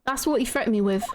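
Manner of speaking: confessing, lightly voiced Cockney character; lightly voiced East End witness. East End witness admits lying in court, lightly in character. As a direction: own up as a lightly acted East Ender.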